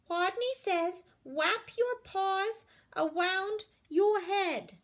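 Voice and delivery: high, slow, small voice; a shy kid daring to speak up; timid Wadney. A narrator's reading. Wadney calling the next order to the class.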